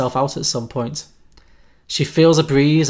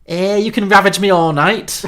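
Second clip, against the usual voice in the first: loud, higher Scouse come-on; Scouse accent; 5 semitones higher, 5 dB louder than usual. Acted. Red replies to Dan at the door, hammed-up Scouse accent. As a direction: belt it out in a hammed-up Liverpool accent. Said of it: "in her Scouse accent".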